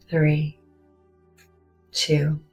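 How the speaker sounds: ordinary delivery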